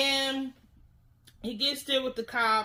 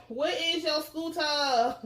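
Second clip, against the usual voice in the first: slow, higher singsong mimicry; teasing imitation; 5 semitones higher, slower than usual. Narrator repeats the catchphrase for laughs, teasing imitation. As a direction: stretch out the mock demand playfully.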